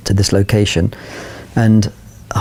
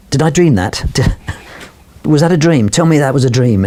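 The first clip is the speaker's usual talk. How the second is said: disbelief; 5 semitones higher than usual. Retold or imitated. Stunned crew after the wave.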